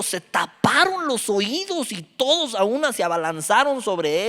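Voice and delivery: preacher reading Acts to the congregation; fiery scripture reading. Acted. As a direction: read the passage like a sermon at full force. Reads Acts 7 aloud.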